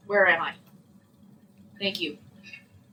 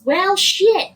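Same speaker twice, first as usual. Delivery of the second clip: frustrated; 11 semitones higher, 9 dB louder than usual. Real, not acted. Game mishap, frustrated outburst.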